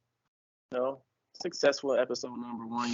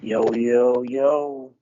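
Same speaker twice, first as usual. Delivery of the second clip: hype yo; 8 dB louder, much slower than usual. Real, not acted. Hype greeting to open podcast.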